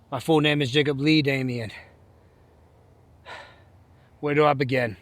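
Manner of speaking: ordinary delivery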